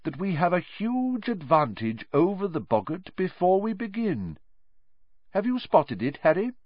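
lightly lifted professor voice for addressing pupils; Professor Lupin teaching. Acted. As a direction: engage the class like a kindly professor.

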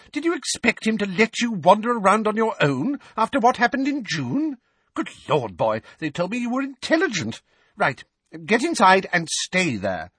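put-on high, brisk scolding old woman; impatient old woman. A narrator's reading. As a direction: hurry the boy along. Mrs Figg impatient.

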